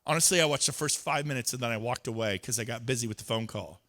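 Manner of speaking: ordinary delivery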